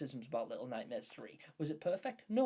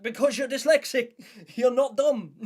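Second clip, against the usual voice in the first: high, loud, laughing insistence; emphatic retold exclaim; 9 semitones higher, 15 dB louder than usual. Telling dyslexic friend she is not dumb, loud laughing insistence.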